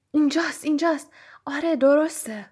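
excited. Acted. Harry finds the mirror.

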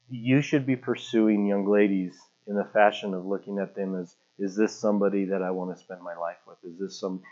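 low, soft advisory tone; a hint of an earnest mentor; lightly voiced youth leader. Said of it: "the guy that was the youth leader".

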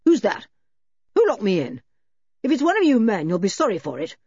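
startled, indignant questions, starting loud; astonished man calling through a shut door. A narrator's reading. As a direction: demand answers in astonishment. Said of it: "astonished voice came through the shut door".

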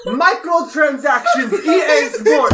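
hammed-up Russian accent, half laughing; bad Russian accent. Contestant pitches greedy microtransactions in bad Russian accent. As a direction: sell it in a clumsy Russian accent. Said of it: "You have really bad accent".

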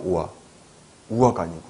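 ordinary delivery

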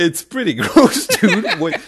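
loud, high laughing protest. Real, not acted. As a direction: laugh while you talk. Laughing about gross room.

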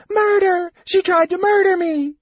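high, far-carrying howl of accusation. Acted. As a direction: shriek it out as if attacked. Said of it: "The woman began to howl".

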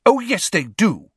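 high, loud indignant retort; angry. A narrator's reading. Girl defending her creatures, angry. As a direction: insist angrily, pitched right up. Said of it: "said Luna angrily".

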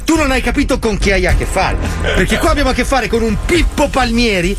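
ordinary talk

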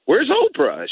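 high, incredulous asking; lightly voiced disbelief; retold question. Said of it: "I go".

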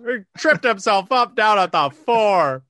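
loud, slow monotone call; emotionless robot. Shown as a demonstration. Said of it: "If I talk louder in a monotone voice".

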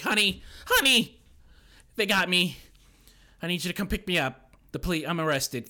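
loud, high call to a wife; hammed-up urgent confession; mock desperate. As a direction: imitate a frantic husband on the phone. Husband's frantic call to wife.

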